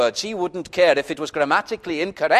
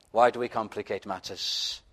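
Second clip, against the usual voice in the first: ordinary delivery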